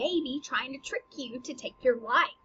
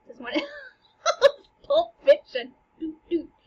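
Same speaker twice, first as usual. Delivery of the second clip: high, laughing through the words; 12 semitones higher, 3 dB louder than usual. Real, not acted. Teacher laughing about the ghost doing a Pulp Fiction move. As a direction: crack up at the joke.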